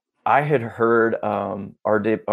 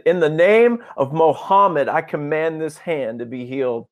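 raised, high public proclamation; louder, ostentatious; 6 semitones higher, 3 dB louder than usual. Retold or imitated. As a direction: command it so everyone around hears. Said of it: "I said it louder".

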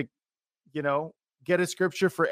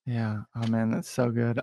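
normal talking voice

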